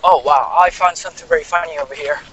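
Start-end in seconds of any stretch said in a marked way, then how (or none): none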